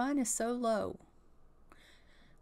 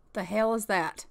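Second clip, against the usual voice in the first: ordinary delivery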